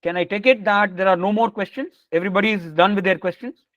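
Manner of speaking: normal talking voice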